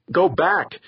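high, loud shout down the tube; urgent shouted command; yelling. A narrator's reading. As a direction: yell a warning at her. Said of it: "he yelled at her".

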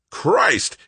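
sudden furious bellow. Acted. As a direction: roar it out in frustration. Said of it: "he bellowed".

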